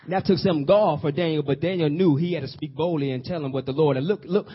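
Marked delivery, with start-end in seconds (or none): none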